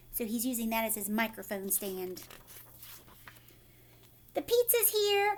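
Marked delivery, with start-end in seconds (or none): 4.3-5.4 high called-out announcement; calling everyone to the table. Acted. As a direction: call it out across the room, pitched high. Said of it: "called the tow truck driver".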